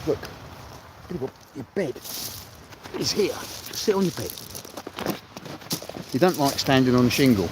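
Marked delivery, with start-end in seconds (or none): 0.1-4.3 higher, softer coaxing voice for the dog. Real, not acted. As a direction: gently coax the dog onto its bed. Coaxing the dog onto bed.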